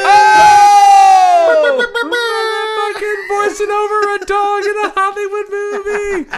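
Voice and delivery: very high, very loud hammed-up whoop; ecstatic shouting. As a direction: scream the big news, stretching it out. Mock celebrating his own movie job.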